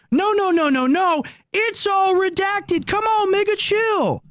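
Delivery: mock whiny denial. Streamer mocking the cover-up crowd in a high whiny voice. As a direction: squeak out a patronising cover-up excuse. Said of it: "And they go".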